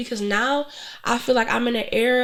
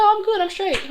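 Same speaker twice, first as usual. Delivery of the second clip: breezy reassurance; 10 semitones higher than usual. Retold or imitated. Retold reply to worried friends.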